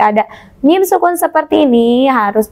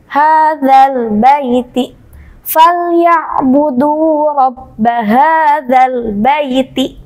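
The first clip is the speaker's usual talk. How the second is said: Quran recitation; much slower than usual. Shown as a demonstration. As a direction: recite each syllable slowly and clearly. Teacher recites the example verse. (lantunan bacaan Quran yang lambat dan keras)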